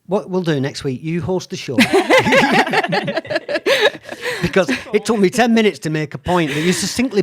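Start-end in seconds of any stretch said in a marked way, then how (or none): 1.8-4.4 high burst of delighted laughter; big laugh. Real, not acted. Host laughing at how neatly the guest made her point, big laugh. 4.4-7.2 laughing. Real, not acted. Laughing through self-mocking remark.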